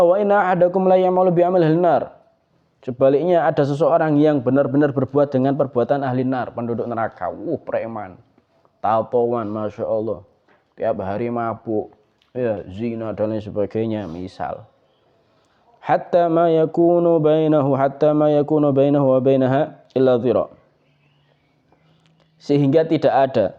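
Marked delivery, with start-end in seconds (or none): 0.0-2.2 hadith recitation. Acted. Preacher quotes hadith in Arabic. 15.8-20.5 loud hadith recitation. Acted. Preacher quotes hadith in Arabic.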